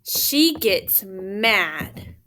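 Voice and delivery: she gets mad, spoken very slowly and low; slow emphatic mad. A narrator's reading. Teacher reading, stressing anger.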